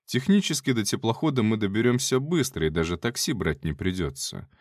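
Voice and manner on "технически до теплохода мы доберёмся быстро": ordinary delivery, nothing marked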